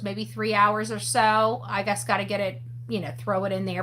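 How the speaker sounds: usual speaking voice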